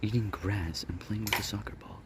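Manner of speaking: hushed wildlife narrator voice; nature documentary. Pretend. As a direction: narrate softly like a nature film.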